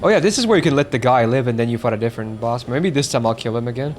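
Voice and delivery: usual speaking voice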